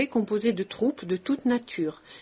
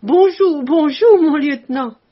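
very loud, much higher, beaming farewell shout; joyful shout; 6 semitones higher, 12 dB louder than usual. A narrator's reading. Tombouctou shouting his farewell to the officer, loud and high. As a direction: call it out big and happy while leaving. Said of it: "et riant toujours, cria".

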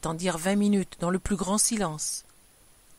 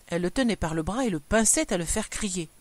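usual speaking voice

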